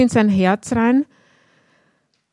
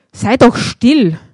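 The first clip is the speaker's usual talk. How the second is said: slow, louder mock rebuke; snapping at him; 6 dB louder, slower than usual. A narrator's reading. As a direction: hush him sharply, like an annoyed crowd. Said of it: "Die Leute fuhren ihn an".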